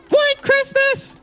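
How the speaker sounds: very high, drawn-out scream; screamed battle cry. Acted. Game master voices Rick the Elf signalling his raiders, screaming. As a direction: scream it as a loud, slow signal. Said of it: "and screams".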